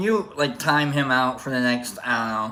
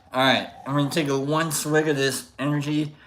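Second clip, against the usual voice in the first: normal talking voice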